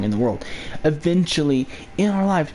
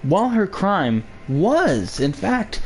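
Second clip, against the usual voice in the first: usual speaking voice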